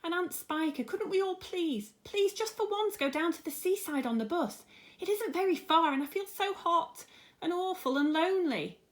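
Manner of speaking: put-on high pleading boy's voice; high childish plea. A narrator's reading. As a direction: beg like a miserable small boy. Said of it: "James cried out".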